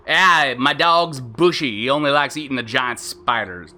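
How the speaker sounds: off-target mock Australian; wrong Australian accent. Shown as a demonstration. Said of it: "but the wrong accent".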